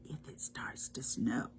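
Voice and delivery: near-whisper, very soft; hushed wondering. Acted. Reader wondering with the children about snow, hushed. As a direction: drop to a whispered musing.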